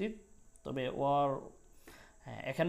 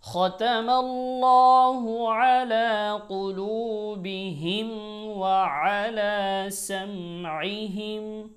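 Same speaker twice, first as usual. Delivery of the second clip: Quran recitation; 3 semitones higher than usual. Shown as a demonstration. Teacher reciting the verses for students. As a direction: chant the verses with long held notes. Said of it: "তেলাওয়াত".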